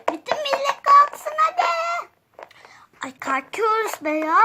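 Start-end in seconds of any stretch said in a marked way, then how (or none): 0.1-1.6 loud high wake-up call; high shouting. Acted. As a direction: shout everyone out of bed. Mother waking everyone.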